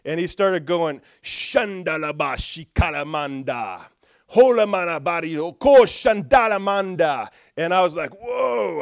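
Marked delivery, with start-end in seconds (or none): 1.2-7.3 bold declaration in tongues, building louder; bold authority. Retold or imitated. Imitating mentor's demonstration of authority, bold declaring prayer. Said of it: "sometimes there's boldness in it". 8.2-8.8 high, drawn-out whoa; awed reaction. Retold or imitated. Preacher replaying feeling power come off him, awed. Retold awe at mentor's power.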